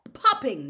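"popping" with a loud, explosive first p; pause, then burst the p of "popping"; popping sound imitated. A narrator's reading. Narrator describing the loud noise, "popping" exploded.

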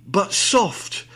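slow theatrical recital; mock Romeo reciting to open the show; hammed-up Shakespeare. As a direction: declaim it like a ham Romeo.

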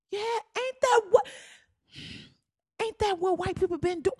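high, incredulous rhetorical questions; real high-pitched indignation; indignant. Real, not acted. Indignant reaction to the plea.

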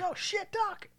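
mock panicked Marty. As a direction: squeak it out like a panicked teen. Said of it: "He's like".

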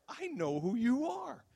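high, slow teasing sing-out; playful retold taunt, drawn out. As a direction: tease them in a loud, high voice. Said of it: "And so I'm playful".

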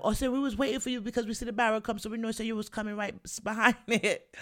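high, quick voicing of an excited cousin; cousin's voice. Cousin voiced in retold story.

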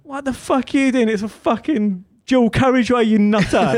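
hammed-up incredulous fury, voice pitched right up; outraged retort. Other driver yells back on the dual carriageway, mock outraged.

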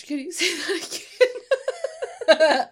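high, loud request through laughter; laughing hard. Real, not acted. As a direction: barely get it out for laughing. Cracking up at co-host's words.

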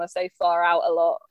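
ordinary delivery

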